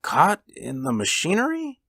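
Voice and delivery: high-pitched, disbelieving echo; dazed. A narrator's reading. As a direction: repeat it as a stunned question. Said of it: "in a dazed fashion".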